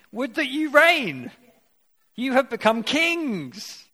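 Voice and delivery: mock them as would-be kings, dripping sarcasm; sarcastic mockery. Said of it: "It is sarcastic".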